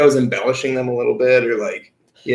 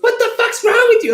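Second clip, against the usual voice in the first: very high-pitched imitation of an outraged sister; mock outraged yelp; retold angry outburst; 4 dB louder than usual. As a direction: screech it like a sister storming in. Sister storms out, confronts him.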